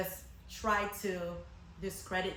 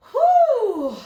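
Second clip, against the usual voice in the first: a big high exhale of disbelief; loud whew; 17 dB louder than usual. Real, not acted. Narrator bracing before the showdown, loud whew.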